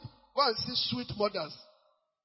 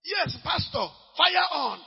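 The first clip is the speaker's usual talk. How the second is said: high, loud cheer; mock cheering; 7 semitones higher, 5 dB louder, slower than usual. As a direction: egg the pastor on. Mimics congregant cheering the pastor.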